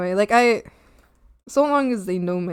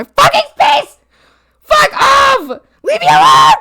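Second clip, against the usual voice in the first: crying shout, much higher and louder; screaming at ex; 9 dB louder, slower than usual. Retold or imitated. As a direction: scream it at him through tears. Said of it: "crying and screaming in the street".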